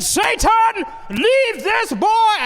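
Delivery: high, loud, drawn-out command; commanding exorcist shout. Retold or imitated. Priest ordering Satan out of the boy, shouted slowly. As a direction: cast out the devil at full voice.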